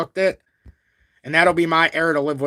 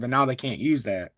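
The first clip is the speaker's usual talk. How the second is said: ordinary talk, nothing marked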